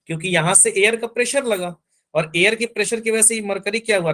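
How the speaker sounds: normal talking voice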